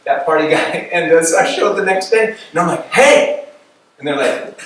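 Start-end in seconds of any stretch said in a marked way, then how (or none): 2.9-3.4 high, loud, bursting hey; excited greeting. Retold or imitated. New convert greeting coworkers next day, excited high hey. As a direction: hail them with big enthusiasm.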